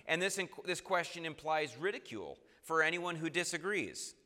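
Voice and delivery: ordinary talk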